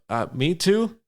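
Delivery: high, uncertain little question; hesitant goblin asking to be included; Jaco. Acted. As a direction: pipe up with pitch rising.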